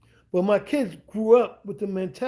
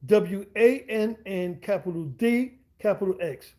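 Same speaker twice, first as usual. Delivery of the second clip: spelling it out; slower than usual. Shown as a demonstration. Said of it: "I'm spelling Swan with the dollar sign".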